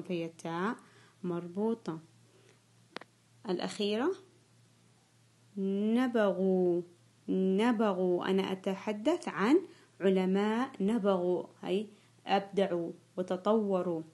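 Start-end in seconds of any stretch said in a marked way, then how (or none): 5.6-8.3 slow dictation. Shown as a demonstration. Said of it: "سوف أنطق الكلمة".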